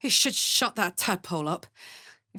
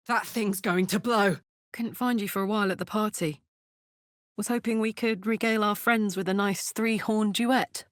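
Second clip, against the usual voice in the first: ordinary talk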